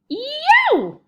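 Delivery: high, loud howl of pain; giant's pained growl. A narrator's reading. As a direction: yowl as the nightmare hits. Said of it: "growled the flesh lump eater".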